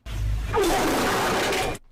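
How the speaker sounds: long, noisy fart noise; imitated fart sound. Streamer walks in and demonstrates the fart, long mock fart noise. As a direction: blow a drawn-out raspberry. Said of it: "the fart sound, you know?".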